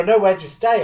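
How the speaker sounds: imitated Scottish driver repeating himself; Scottish taxi driver. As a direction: press the question in a Scottish accent. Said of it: "And they'd go".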